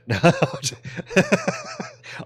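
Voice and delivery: high, bursting laugh at his own joke; big laugh. Real, not acted. Streamer laughing at his own joke about the French, bursting laugh. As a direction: crack up at your own dark joke.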